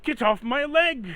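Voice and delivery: high, loud yelps of pain; panicked son. Acted. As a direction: yell at the thing burning your leg. Son attacked by a burning slime.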